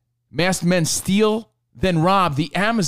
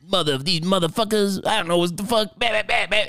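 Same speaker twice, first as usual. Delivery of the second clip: imitating dad's rant; 3 semitones higher than usual. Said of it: "my dad just went on a rant".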